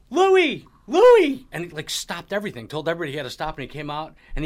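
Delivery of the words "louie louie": high, very loud excited call of a name; screaming. Retold or imitated. Man screaming to a friend down the hallway from a meeting, screaming. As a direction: holler the name at the top of your voice. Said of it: "and he's screaming".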